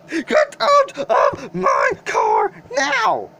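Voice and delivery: high, slow yell. Acted. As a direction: yell it out, stretching every word. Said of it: "Why are you yelling at me".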